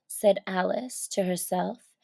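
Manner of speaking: ordinary delivery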